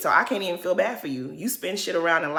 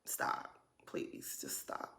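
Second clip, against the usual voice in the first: breathy whisper, pleading; hushed, exasperated whisper; whispered plea; 23 dB quieter, slower than usual. Real, not acted. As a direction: beg under your breath to make it stop. Begging the memory to stop.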